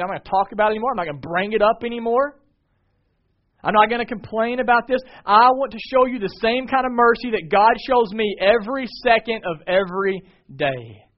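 high, loud, building declaration; impassioned preaching. Real, not acted. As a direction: drive each promise home at full pulpit volume. Preacher building to forceful climax.

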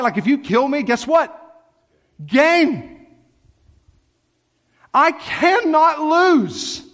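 high, intense sermon climax building to a shout; triumphant full-force preaching. Real, not acted. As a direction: build to a triumphant cry, stretching the last phrases.

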